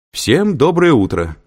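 high, loud, drawn-out greeting to a hall; calling out. A narrator's reading. Lecturer greeting five hundred students from the stage, calling out. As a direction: project it slowly over a big crowd. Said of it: "крикнул Лэнгдон с обширной сцены".

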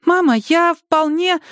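put-on high, slow childlike plea; a scared child reassuring her mother; frightened little girl. A narrator's reading. Girl reassuring her mother, high scared childlike voice.

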